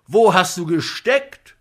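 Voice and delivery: loud, high accusing question; angry demand. A narrator's reading. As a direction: fire the question at him, indignant. Comic berates the absent actor.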